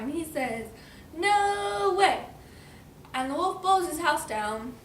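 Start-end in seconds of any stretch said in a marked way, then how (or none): 1.2-2.3 high, loud storybook refusal; defiant pig. Acted. Pig refuses the wolf, high loud defiance. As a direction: stretch it out stubbornly.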